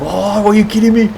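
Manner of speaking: high, loud mock outrage, jealous; excited disbelief. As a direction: squeal it like you missed the fun. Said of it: "and I was like".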